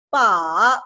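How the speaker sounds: पा dipping low then rising; third tone पा. Shown as a demonstration. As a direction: dip पा down then bring it up. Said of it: "पहले आपकी आवाज नीचे को आएगी and then ऊपर को जाएगी".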